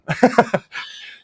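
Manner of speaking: a breathy high-pitched giggle at himself. Real, not acted. Laughing at his own Hollywood ego.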